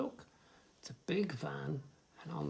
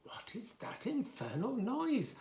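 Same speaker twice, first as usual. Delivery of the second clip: higher, indignant cat; 9 semitones higher than usual. Acted. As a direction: voice the cat, high and put out. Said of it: "asked the cat".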